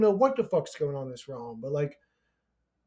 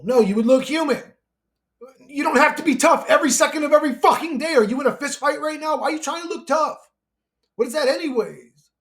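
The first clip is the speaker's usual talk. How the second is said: raised, fast, indignant rant at viewers; heated rant; 6 semitones higher, 5 dB louder than usual. Real, not acted. Ranting at viewers who hide feelings, heated and raised. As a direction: scold them for hiding feelings.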